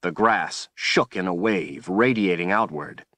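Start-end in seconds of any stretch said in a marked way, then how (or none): none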